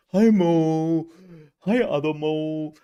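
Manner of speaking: high, loud drawn-out hello; calling out greetings. Real, not acted. Streamer greeting each Mo in chat, loud calling.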